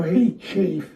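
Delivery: high-pitched, pleading objection; retold indignant protest. Wrestler confronts Chief over Joe, indignant protest. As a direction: act out taking up a friend's case.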